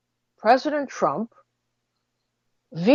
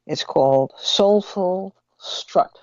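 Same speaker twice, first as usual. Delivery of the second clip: ordinary talk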